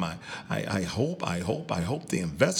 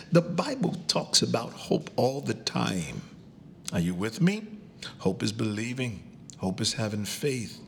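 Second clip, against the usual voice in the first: ordinary talk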